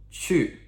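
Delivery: "chu" starting high and falling sharply; descending fourth tone. Shown as a demonstration. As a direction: drop the pitch sharply on "chu". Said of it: "fourth descending tone".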